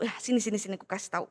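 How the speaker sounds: low, soft conspiratorial murmur; secretive hush. Acted. Poltak pulls his friend close to share his secret, hushed.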